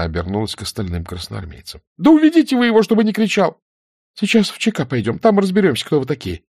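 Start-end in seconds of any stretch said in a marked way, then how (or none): 2.0-3.6 high, loud plea to the men; upset shouted demand. A narrator's reading. Nina orders him taken away. 4.1-6.4 put-on high-pitched threatening young woman; indignant threat. A narrator's reading. Nina threatens the soldiers.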